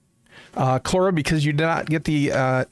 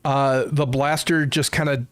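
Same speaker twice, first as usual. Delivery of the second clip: usual speaking voice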